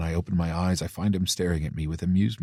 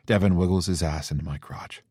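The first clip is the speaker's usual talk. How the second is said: ordinary delivery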